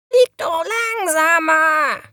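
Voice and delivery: high, drawn-out squawking scold; scolding raven. A narrator's reading. Raven tells off the reckless little witch, scolding. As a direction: shout it crossly as a raven. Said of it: "schimpfte der Rabe".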